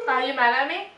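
ordinary delivery

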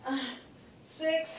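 ordinary talk

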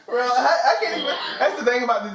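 laughing, fast and loud. Real, not acted. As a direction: talk through the laughter. Cracking up at a TTS donation.

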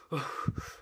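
soft, shaky-effort exhales; acted breaths through pain; heavy pained breathing. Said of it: "still breathing heavily".

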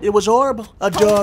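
Trump. Retold or imitated. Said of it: "He's doing Trump".

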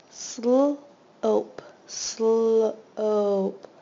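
loud and segmented; sounding out slope. Shown as a demonstration. Teacher sounding out a word for students, loud and segmented. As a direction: split slope into Sl and ope, loud. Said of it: "long O".

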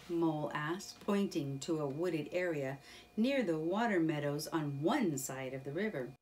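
ordinary delivery, nothing marked

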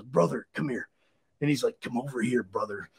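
low hushed wrestler growl; sneaky whisper. Retold or imitated. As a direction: hiss it like sneaking up.

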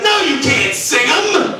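mock furious cop yell; angry cop. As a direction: bark at the drunk to stop singing. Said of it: "He, he was like".

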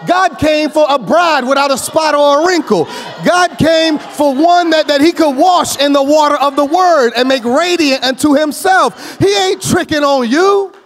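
loud, high, building sermon cry; preaching at full force. Real, not acted. Preacher crying out to the congregation, full force. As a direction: preach it out to the whole church.

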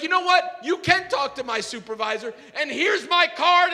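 high, loud angry retort; worked-up, explosive comeback; building to froth. Acted. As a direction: act out losing it completely. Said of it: "I am just building to a total froth".

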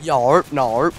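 terse mock West Country grunts; rustic rolled-r yokel; Somerset farmer accent. Friend clowning as farmer, Somerset accent. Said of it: "I'm a farmer from Somerset".

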